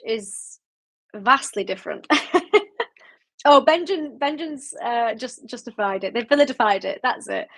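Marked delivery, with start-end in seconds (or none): none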